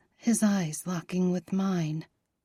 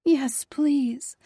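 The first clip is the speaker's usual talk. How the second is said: higher voiced breathy yes please; 5 semitones higher than usual. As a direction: breathe it out eagerly. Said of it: "I breathed".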